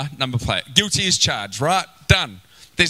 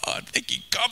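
laughing thanks; 6 semitones higher, 6 dB quieter than usual. Retold or imitated.

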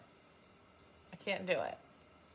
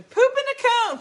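high, loud shrieking chant; screaming; 11 dB louder than usual. Retold or imitated. Retelling running through the house, high loud screaming. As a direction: shriek it like a kid. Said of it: "came running through the house screaming".

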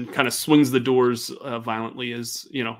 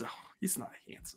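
whispered, quick denial; 17 dB quieter, faster than usual. Acted. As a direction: brush it off under your breath. Elizabeth denies, embarrassed.

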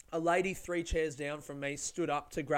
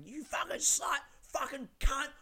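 high-pitched imitation of a yelling woman; mock yelling; 10 semitones higher than usual. Retelling a train passenger's rant, mock high yelling. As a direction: mimic her yell in a much higher voice. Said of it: "she was yelling".

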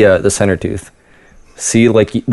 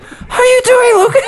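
high, winded voice; breathless boss; slower than usual. Acted. Exhausted boss greeting Luke, hammed-up breathless impression. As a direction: gasp it out like you're totally spent. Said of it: "Losing breath with every word".

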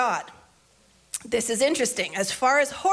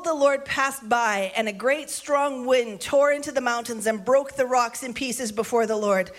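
ordinary talk, nothing marked

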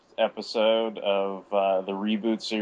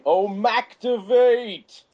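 high, drawn-out excited cry; excited catchphrase; 13 semitones higher, 6 dB louder, much slower than usual. Acted. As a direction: belt the catchphrase out slowly. Shouted catchphrase on introduction.

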